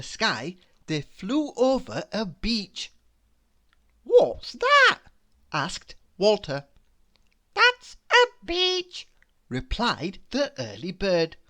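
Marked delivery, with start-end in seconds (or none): 4.0-5.0 high, loud squeaky worm question; curious worm voice. A narrator's reading. 7.5-9.1 high, loud, slow kindly bird; comforting bird voice. A narrator's reading. Bird answers.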